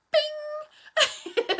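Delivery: high, laughing sound-effect ping; a cartoon ping, laughing; comic bing. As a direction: pop the eyes open with a squeaky bing. Tech's eyes pop wide.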